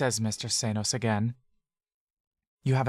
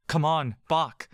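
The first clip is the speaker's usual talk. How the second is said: urgent call; 4 semitones higher than usual. Acted. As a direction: hurry him along with a raised voice. Urging friend to run.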